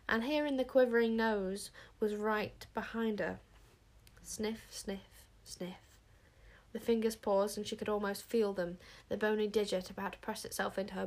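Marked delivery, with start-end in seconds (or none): 4.2-6.0 soft, suspenseful word by word; hushed sniffing. A narrator's reading. Nose sniffing behind Wish, hushed suspense. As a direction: let each one get quieter.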